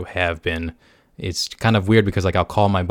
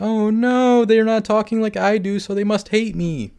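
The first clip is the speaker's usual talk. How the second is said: much higher, whiny panic voice; mock panicked texter; 13 semitones higher than usual. Mocking anxious texters, high and panicky.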